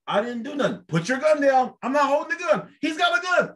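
high, rising panicked protest; mock panicked plea. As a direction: voice a frightened man pleading with police. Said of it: "they'll be like".

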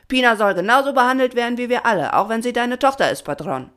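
high, fast, loud objection; loud protest. A narrator's reading. A swarm member objects to the patron, high indignant protest. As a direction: push the complaint at the leader.

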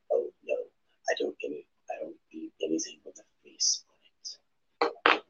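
low, soft mock vegan. Imagining himself as a vegan refusing meat, low and soft. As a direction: lightly play the prissy vegan. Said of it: "Be like".

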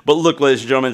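ordinary talk, nothing marked

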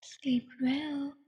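soft unvoiced farewell whisper. Killer to dying victim, eerie whisper. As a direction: breathe it out in a cold whisper.

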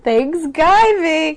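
"Thanksgiving" as thanks-GUY-ving, very slow and loud; mocking mispronunciation. Echoing co-host's tease, "Thanksgiving" stretched to GUY. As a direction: drag out "Thanksgiving" with a big guy vowel. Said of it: "that's what you sound like".

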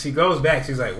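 usual speaking voice

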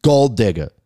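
ordinary talk, nothing marked

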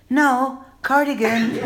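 squeaky yelled punchline; comic high voice; high mock reply. Knitting woman yells back.

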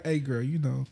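hushed, suave come-on; mock pickup line. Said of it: "that's a crazy pickup line".

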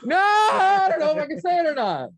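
loud, high-pitched protest; alarmed shout. Real, not acted. Host stops crew over a swear word, alarmed shout.

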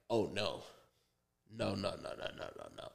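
quiet panic dying to breath; panicked under breath. Retold or imitated. Seeing forgotten weed as cops search, a fading whispered no.

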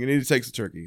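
normal talking voice

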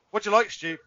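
lightly acted interviewer feeding a gag; mock questioner. Acts out quizzing friend on favourite.

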